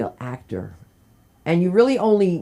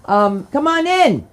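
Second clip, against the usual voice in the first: welcoming call; 9 semitones higher, 5 dB louder than usual. Real, not acted. Calling guest onstage.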